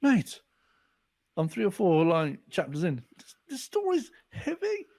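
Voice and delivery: high, excited mock friend voice; imitating Leroy. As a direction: play an excited friend on the phone, pitched high. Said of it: "Leroy phoned me and went".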